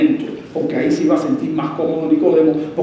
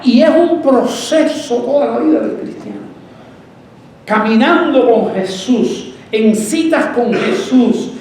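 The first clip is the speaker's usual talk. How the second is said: high, slow, emphatic preacher delivery; impassioned preaching; 7 semitones higher, 4 dB louder, slower than usual. Acted. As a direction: build to a ringing, repeated name, each one punched. Preaches at raised pitch.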